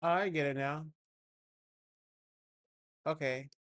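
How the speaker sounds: ordinary delivery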